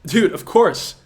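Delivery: normal talking voice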